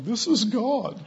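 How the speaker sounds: high-pitched echo of amazement; mock awestruck. Cashier reacting to the healing, mock awestruck. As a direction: imitate an astonished onlooker, voice shooting up.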